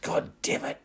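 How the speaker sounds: loud, voiceless angry hiss; hissed curse. A narrator's reading. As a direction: hiss the oath through gritted teeth. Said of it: "Then he hissed".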